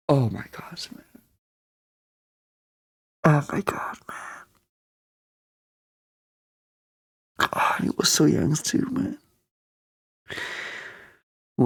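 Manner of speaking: hushed, broken murmur; grieving whisper. Real, not acted. Fan mourning a young soldier, hushed grief. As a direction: barely breathe it out in grief.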